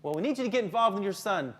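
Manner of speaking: pointed, accusatory scolding; scolding teacher. Acted. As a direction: act out a teacher blaming the parent. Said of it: "I think some teachers do that".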